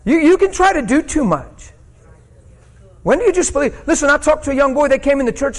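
high, loud preacher's cry; fiery preaching. Real, not acted. Preacher urging the congregation to just believe, shouting high. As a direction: hammer it home to the pews.

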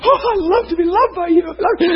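high-pitched, laughing imitation of bliss; mock blissful gushing. Preacher voicing Jesus basking in worship, mock bliss. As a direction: play someone swooning at being adored. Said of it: "And he's going".

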